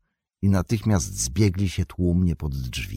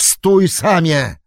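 much higher, louder, slow shout; 11 semitones higher, 8 dB louder, slower than usual. Acted. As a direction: stop him with a cry. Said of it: "krzyknął Frodo".

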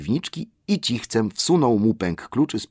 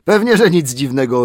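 mocking echo; 6 semitones higher, 9 dB louder than usual. A narrator's reading. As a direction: throw his words back at him.

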